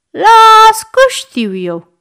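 high, loud, drawn-out teasing; knowing child. A narrator's reading. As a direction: draw it out like a child who isn't fooled. Boy sees through fake crying. (copil șmecher, tărăgănat)

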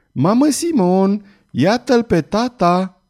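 put-on high, slow, bright childlike call; joyful child's cry. A narrator's reading. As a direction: call out like a laughing little girl. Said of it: "râzând și strigând ca o micuță nebunatică". (strigăt vesel de copil)

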